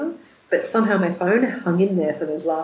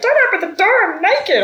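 much higher, louder mock shriek; mock yell; 13 semitones higher, 7 dB louder than usual. Sister yelling at the brother-in-law bursting in, mock shriek. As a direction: shriek it through the door. Sister shouting through door.

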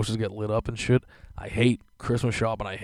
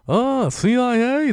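much higher, slow, loud jeering call; sing-song taunt; mocking deli guy again; 7 dB louder, much slower than usual. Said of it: "yells over the whole line".

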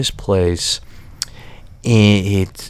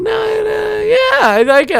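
much higher, hasty polite agreement; fake enthusiasm. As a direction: cover your dislike eagerly. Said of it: "And I'm like".

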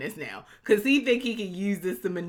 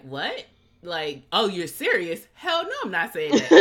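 mock incredulous Oliver; 2 semitones higher, 8 dB louder than usual. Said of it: "Oliver was like".

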